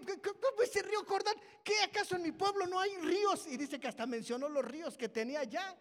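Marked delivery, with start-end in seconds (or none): 0.1-3.5 loud, high-pitched mock outrage; acted indignation. Preacher acts out Naamán offended by the Jordán order, indignant. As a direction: stammer into an offended, incredulous protest, retelling it. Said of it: "Naamán se enoja".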